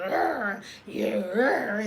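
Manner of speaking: a hint of a growling gangster rapper. Imitating the angry driver in a gangster voice. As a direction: lightly growl it like a tough guy.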